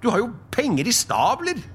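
very high-pitched, lively voice; retorting nephew. Acted. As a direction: tease uncle with an exclamation. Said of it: "repliserte Fred". (svært lys, livlig replikk)

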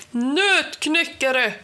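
high, drawn-out summoning shout. A narrator's reading. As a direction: yell to summon your guards. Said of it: "skrek Helgerån".

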